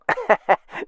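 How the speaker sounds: high-pitched chuckle; squeaky little laugh. Acted. Mock laugh after joke.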